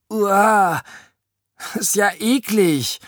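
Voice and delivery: high-pitched squeal of revulsion; disgusted. A narrator's reading. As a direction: recoil from something revolting. Oda recoils from farting slime. (angeekelt und hoch)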